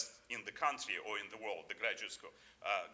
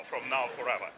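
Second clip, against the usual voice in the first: ordinary delivery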